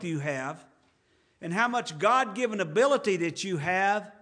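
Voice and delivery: preacher warming to his theme; rising preaching fervor. Acted. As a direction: lift the voice and press the point to the congregation. Preacher builds to full preaching force.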